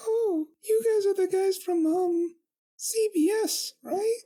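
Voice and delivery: squeaky high princess voice, friendly; high pony princess. Acted. Twilight voiced in the reading.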